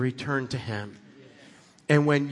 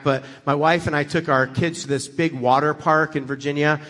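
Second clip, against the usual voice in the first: ordinary talk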